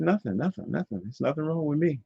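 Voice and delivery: high, softened protest of innocence; acted-out innocent backpedaling; defensive denial. Caught staring, hastily denies it.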